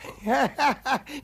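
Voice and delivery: high dismissive laugh; brushing-off laugh. Acted. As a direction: laugh the legend off. Laughs off the warning.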